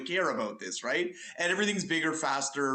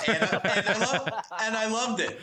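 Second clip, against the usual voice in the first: laughing through the admission; 3 semitones higher than usual. Real, not acted. Panelist laughing as he admits loving the trailer.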